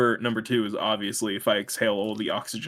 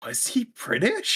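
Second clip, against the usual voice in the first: mock confused question; 12 semitones higher, slower than usual. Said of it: "And I was like".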